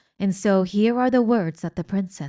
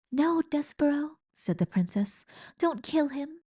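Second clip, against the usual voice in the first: higher, gentle pleading; lightly voiced princess; 8 semitones higher, 3 dB quieter than usual. Princess begging the mouse not to kill. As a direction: plead softly for mercy.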